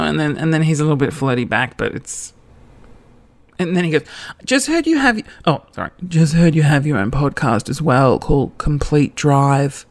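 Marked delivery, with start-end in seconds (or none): 4.4-5.2 high-pitched false start at reading his message; lifted, squeaky-high message read; wrong high voice. Retold or imitated. Starts his message, then corrects herself. 6.1-9.9 restrained, level-pitched read of his text; lightly voiced man's message, evenly pitched; crush's message voice.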